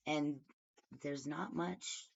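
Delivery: normal talking voice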